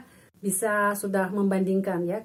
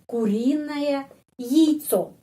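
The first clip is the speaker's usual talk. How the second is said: slow demo; 3 semitones higher, slower than usual. Shown as a demonstration. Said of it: "kenapa ya dibaca яйцо".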